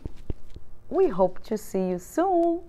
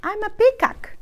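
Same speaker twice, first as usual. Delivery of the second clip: proud peacock; 10 semitones higher, 4 dB louder than usual. Acted. Peacock character claims.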